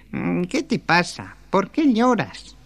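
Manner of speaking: high, slow little dwarf voice; a tiny creature gently asking, drawn out. Acted. Dwarf asking the crying girl why she weeps, in a high slow voice.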